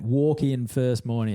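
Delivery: normal talking voice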